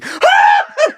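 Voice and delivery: high, loud startled shriek; acted scream. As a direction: shriek as the old lady who walks in. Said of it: "she starts screaming".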